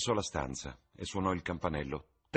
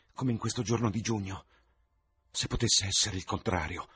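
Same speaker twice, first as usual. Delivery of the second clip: higher and louder, growing desperate; anguished outburst; 5 semitones higher, 9 dB louder than usual. Acted. Dorian davanti al suo ritratto, disperato e acceso. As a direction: plead against growing old, voice rising.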